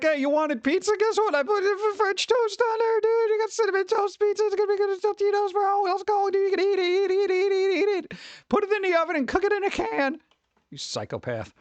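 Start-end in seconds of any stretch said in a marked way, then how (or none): 0.0-10.2 very high, manic squeaky chef voice; frantic falsetto send-up of a crazy cook; mad chef. Retold or imitated. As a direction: rattle it off like a crazed chef. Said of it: "this chef over here looks absolutely mad".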